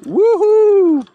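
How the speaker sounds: high joyful whoop; excited whoop. Real, not acted. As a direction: let out a big happy whoop. Whooping on a fast straight.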